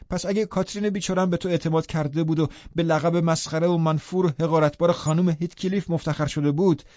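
heated scornful retort; angry taunt. A narrator's reading. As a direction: throw the insult at him heatedly. Taunting Heathcliff in heated quarrel.

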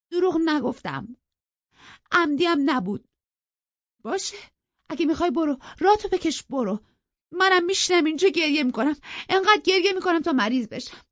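high-pitched, loud, tearful tantrum; choked-up protest. A narrator's reading. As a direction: wail it petulantly, on the verge of crying. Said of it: "با بغض گفت".

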